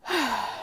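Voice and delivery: a quiet releasing sigh; soft sigh. Shown as a demonstration. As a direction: let the breath out in a sigh. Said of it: "this sighing thing that I do".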